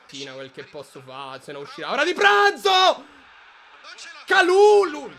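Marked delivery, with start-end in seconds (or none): 1.9-5.1 shout. Real, not acted. Fan outburst.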